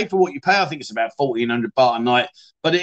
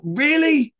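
a sharp, high "Really?" in disbelief; can't believe what he's reading; surprised; 11 semitones higher than usual. Real, not acted. Reacting to a viewer's comment, surprised. Surprised at never having apple sauce.

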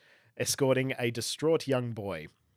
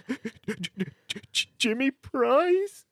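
put-on high stammering crying boy; tearful boy; 9 semitones higher, slower than usual. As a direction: stutter out your name through tears. Distraught boy stammering his name.

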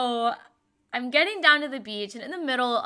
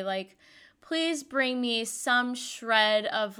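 ordinary delivery

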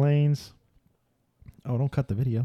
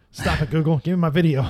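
raised, higher voice playfully scolding Google; mock demand; 5 semitones higher, 5 dB louder than usual. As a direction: playfully order the search engine around. Mock-scolding Google over a failed search.

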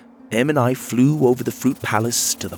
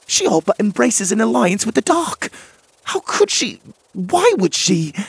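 agitated; 13 semitones higher, 4 dB louder than usual. Acted. Em agitated, wings fluttering.

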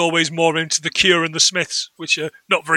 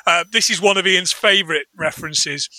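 ordinary delivery

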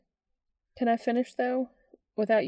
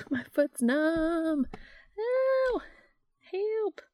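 high, loud yelp of pain; pained outcry; 8 semitones higher, 5 dB louder, slower than usual. Real, not acted. Numb foot while standing up, loud yelp. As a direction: cry out as the foot tingles.

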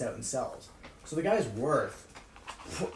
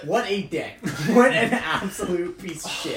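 laughing; 6 semitones higher, 10 dB louder than usual. Retold or imitated. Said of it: "he was like".

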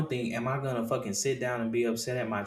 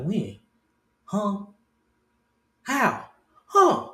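rising higher with each eager question; curious questions; 6 semitones higher, much slower than usual. Retold or imitated. Friend just came out, he eagerly asks higher and higher. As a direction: fire off curious questions, climbing up.

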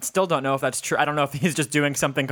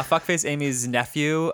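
ordinary talk

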